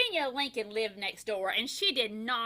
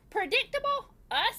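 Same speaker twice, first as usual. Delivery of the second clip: high, startled disbelief; astonished husband, much higher; surprised question; 12 semitones higher than usual. A narrator's reading. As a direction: squeak it out, taken aback. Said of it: "said Mister Watson".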